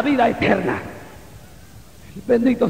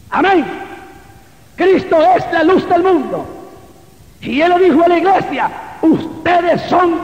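loud, high preaching; prédica a plena voz; 6 semitones higher, 4 dB louder than usual. Real, not acted. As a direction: proclaim it to the whole church, raised voice. Preaching at full force. (predicando con fuerza, voz alta y aguda)

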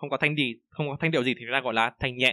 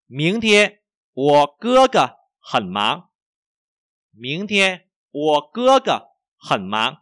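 slow, high, emphatic tone drill; language teacher model reading; 3 semitones higher, 8 dB louder, much slower than usual. Shown as a demonstration. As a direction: stretch each word for learners. Models sentence for learners. (đọc mẫu chậm, rõ thanh)

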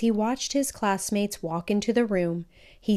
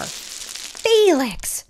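sharp higher call of his name; mom's yell; 9 semitones higher, 4 dB louder than usual. A narrator's reading. Mom yelling at Felix over spilled cereal, sharp call. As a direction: snap his name like an exasperated mother. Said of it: "yelled his mom".

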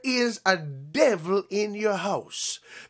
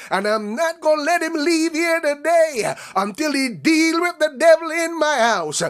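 desperate mother; 4 semitones higher than usual. Acted. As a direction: cry it out like a mother at the end of her rope. Preacher voicing the desperate woman.

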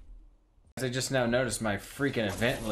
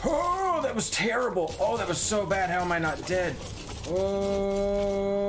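high, stunned cry of disbelief; shocked to still be alive; 8 semitones higher than usual. Real, not acted. Narrowly survives a fight.

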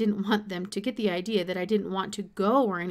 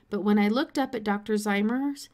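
ordinary delivery

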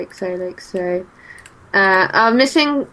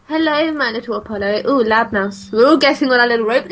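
normal talking voice

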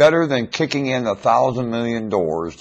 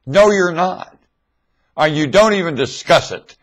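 angry rebuke; 8 semitones higher, 6 dB louder than usual. Real, not acted.

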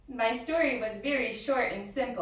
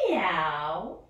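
a louder, rising meow; cat meow; 3 semitones lower, 6 dB louder than usual. Acted. The cat greets the dog in the story, a louder rising meow. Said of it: "said the cat to the dog".

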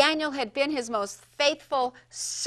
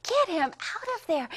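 overjoyed; 7 semitones higher, 7 dB louder, faster than usual. Acted. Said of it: "oh, he was so overjoyed".

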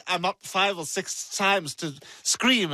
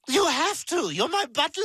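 high-pitched demanding protest; high, indignant demand; bossy insistence; 9 semitones higher than usual. Acted. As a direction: insist like an entitled child. Child demands help after refusal.

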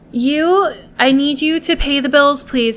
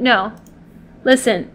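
ordinary talk, nothing marked

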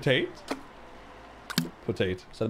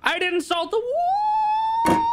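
half-laughing cry; 3 dB louder than usual. Real, not acted. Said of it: "Stop, I'm crying".

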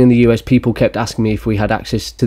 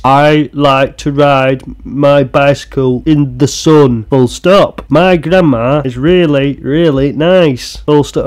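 very slow, higher sing-song reading; stilted test reading; 4 semitones higher, 3 dB louder, much slower than usual. Acted. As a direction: read it laboriously, word by word. Acts out reading test for citizenship.

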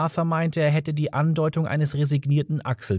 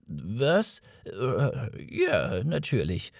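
mildly baffled; 5 dB quieter, much slower than usual. A narrator's reading. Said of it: "leicht verblüfft".

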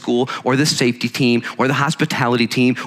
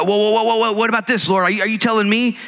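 fearful questioning; 10 semitones higher, faster than usual. Retold or imitated. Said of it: "in our fears".

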